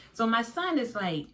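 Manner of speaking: ordinary talk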